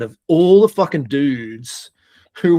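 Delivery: usual speaking voice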